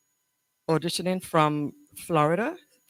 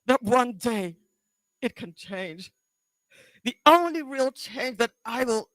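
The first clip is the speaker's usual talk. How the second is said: higher-pitched, halting confrontation with long pauses; anguished, accusing daughter; 13 semitones higher than usual. Acted. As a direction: confront Mom, close to tears, with bursts of force. Audition monologue, daughter confronting mother.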